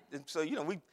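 ordinary delivery

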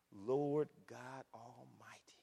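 slow, hushed awe; soft and low, drawing out each word; reverent. Acted. Preacher voicing the angels' worship, slow hushed reverence. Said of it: "the angels bow down to him".

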